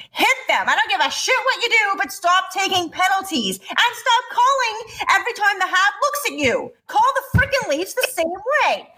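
high-pitched frustrated tirade; fired-up rant. Real, not acted. Fan finishing her rant at the refs, fired up and high. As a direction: build the rant to a peak. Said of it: "That was spectacular".

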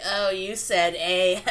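drawn-out teasing imitation; slow, gleeful "gotcha" as friends would; mock teasing. Imitating friends when the A slips out, teasingly drawn out.

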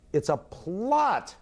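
hammed-up outburst at the climax; mock shout. Oedipus at the aria's climax accuses Creon, retold mock shout. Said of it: "he shouts".

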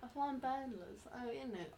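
mock British echo; British accent. Co-host echoing his fine balance, mock British accent. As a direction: parrot it back in a British accent. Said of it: "like, a British accent or something".

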